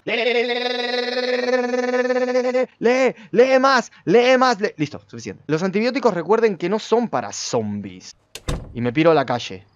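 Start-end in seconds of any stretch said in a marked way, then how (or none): 0.0-4.7 high, rattled-off stutter of urging; rapid reading command. Pretend. Player hurrying the character through a book, frantic chanting. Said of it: "léelo rápido".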